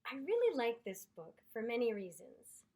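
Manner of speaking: usual speaking voice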